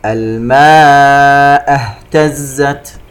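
slow chant, long held aa in "الماء"; connected madd. Shown as a demonstration. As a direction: stretch "الماء" four counts, then a clear h in "اهتزت". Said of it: "مد متصل يمد بمقدار أربع حركات". (مد "الماء" أربع حركات بترتيل بطيء)

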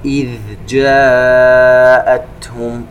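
جاءتهم with the long aa held four beats; slow chanted جاءتهم with held vowel; long madd. Shown as a demonstration. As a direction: stretch جاءتهم slowly. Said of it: "مد متصل في كلمة جاءتهم".

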